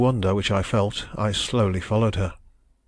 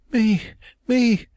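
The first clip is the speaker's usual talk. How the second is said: high, pained woman's voice, louder; distressed young woman; 14 semitones higher, 4 dB louder than usual. A narrator's reading. Young woman protesting her love, high and anguished. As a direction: cry it out as the wronged heroine. Heroine's voice, narrator reads.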